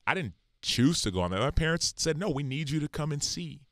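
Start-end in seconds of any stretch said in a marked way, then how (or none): none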